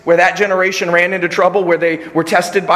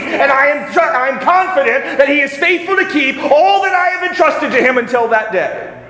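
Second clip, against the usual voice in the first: higher, louder impassioned preaching; full-force preaching; 8 semitones higher, 3 dB louder than usual. Acted. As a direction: drive it home to the congregation.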